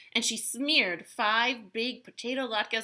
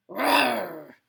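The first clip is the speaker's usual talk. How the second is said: loud imitated bear roar; bear growl; 8 dB louder than usual. A narrator's reading. The bear answers Baba Braina at the door, growling. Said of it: "growled the bear".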